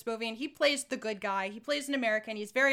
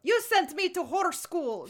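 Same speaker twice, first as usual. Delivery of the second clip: slow, higher hammed-up Russian accent; mock Russian accent; 4 semitones higher than usual. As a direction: quote her in a bad Russian accent. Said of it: "That's what she says at one point".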